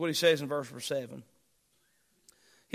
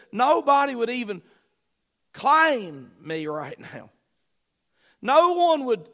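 desperate cry; 6 semitones higher, 6 dB louder than usual. Acted. Preacher voices desperate David.

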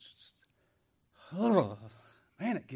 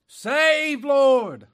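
much higher, much louder plea; desperate cry; 12 semitones higher, 16 dB louder than usual. Acted. A lost soul crying to the Lord, desperate. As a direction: cry out for rescue.

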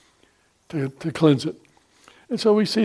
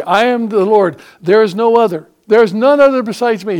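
loud, higher, insistent declaration; booming proclamation; 5 semitones higher, 6 dB louder than usual. Real, not acted. Preacher declares God's words to the congregation, loud and insistent. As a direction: hammer each claim home.

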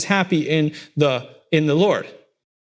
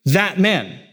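"That" hit firmly, higher; pointed "That man"; stress on that; 5 semitones higher than usual. Shown as a demonstration. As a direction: punch "That" before "man". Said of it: "with an emphasis on that word".

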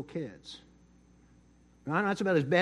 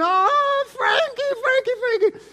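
high, loud wailing cry; keen the name like a grieving old Italian grandmother; sobbing shout, much higher and louder; 8 dB louder, slower than usual. Retold or imitated. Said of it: "she starts screaming".

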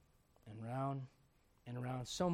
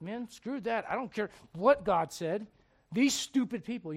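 fed-up Moses; 6 semitones higher, 9 dB louder, slower than usual. Retold or imitated. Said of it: "And Moses is like".

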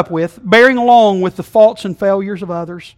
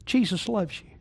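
quiet tender; 2 semitones higher, 9 dB quieter than usual. Acted. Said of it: "Can I say that again?".

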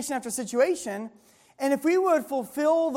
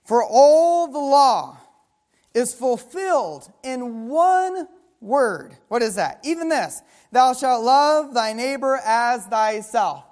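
slow, louder pulpit reading of the verse; preacher reading scripture with force; solemn scripture reading; 4 dB louder, slower than usual. Acted. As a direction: proclaim each word slowly and weightily. Reads scripture slowly, loud.

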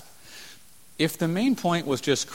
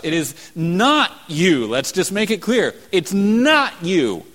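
emphatic preaching; 5 semitones higher, 6 dB louder than usual. Acted. Preacher drives home the point.

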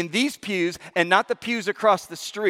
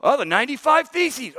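yelling; 7 semitones higher than usual. Retold or imitated. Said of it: "Someone yelled".

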